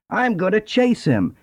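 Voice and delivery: high, cocky donkey voice; eager donkey. A narrator's reading. Donkey boasts to the rooster, high and cocky. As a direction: puff up like a bold donkey. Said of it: "the donkey ran after the lion".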